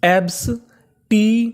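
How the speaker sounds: "एब्सटीमियस" slowly split into separate syllables. Shown as a demonstration. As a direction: sound out "एब्सटीमियस" piece by piece with clear gaps. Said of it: "तो meaning क्या बनी हमारी".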